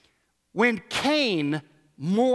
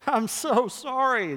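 drawn-out, higher mock-sorry child; hammed-up sorrow, slow and higher; put-on remorse; 6 semitones higher, slower than usual. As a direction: drag out a fake apology like a kid. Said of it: "seen your kids put on mourning".

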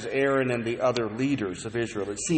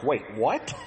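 imitating baffled wives, rising sharply on "what"; incredulous wives, lightly acted; mock disbelief. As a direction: play a comic double take. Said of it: "These wives are like".

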